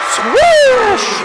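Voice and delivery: squeaky cracked excited yell; voice cracking. Real, not acted. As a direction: voice cracks high on a shout. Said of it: "heard your voice crack like that before".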